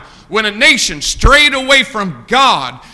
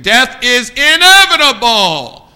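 loud, drawn-out, higher proclamation; preacher's cry; 4 semitones higher, 6 dB louder, slower than usual. Real, not acted. Preacher driving the point home to young people, loud preacher's cry. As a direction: thunder it out slowly. Same point repeated at full force.

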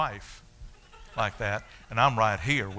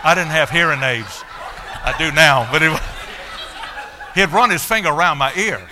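laughing while telling; 5 semitones higher, 10 dB louder than usual. Real, not acted. Laughing through embarrassing memory.

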